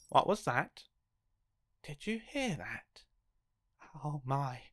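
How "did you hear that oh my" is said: plain reading voice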